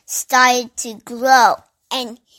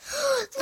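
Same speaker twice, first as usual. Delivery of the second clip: sharp gasp; 13 semitones higher, 8 dB quieter than usual. Acted. Sudden sighting.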